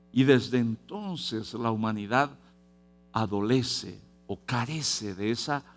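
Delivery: normal talking voice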